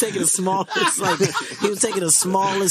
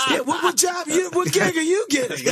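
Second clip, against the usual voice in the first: high, mocking jab; imitating Bill; 10 semitones higher than usual. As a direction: throw it at Kevin mockingly. Bill lashing out.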